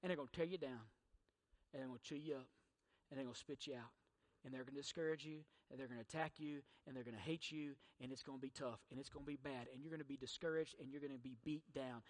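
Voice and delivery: soft, low, heavy list of blows; a preacher dropping to a grim murmur; hushed litany. Acted. Fading litany of hardships.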